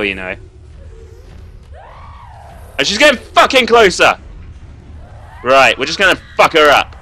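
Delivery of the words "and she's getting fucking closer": high, loud alarmed cry; alarmed shout. Real, not acted. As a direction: cry out as she closes in. Monster closing in.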